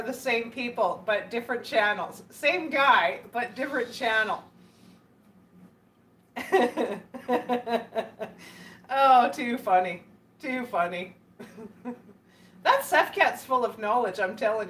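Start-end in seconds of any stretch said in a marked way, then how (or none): 6.4-8.3 long breathy giggling laugh; amused laugh. Real, not acted. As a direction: crack up in a long run of chuckles. Amused by the live chat.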